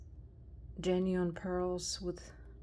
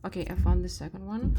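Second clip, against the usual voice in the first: a louder, much faster, eager announcement; excited find; 7 dB louder, much faster than usual. Real, not acted. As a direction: blurt it out quickly, thrilled to find it. Finding the matching earring again.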